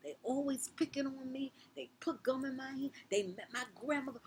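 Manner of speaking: high, loud furious rant; venting rage. Retold or imitated. To the teacher about the bullies, a high furious rant. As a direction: pour it all out at the teacher. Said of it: "Oh, I just went in".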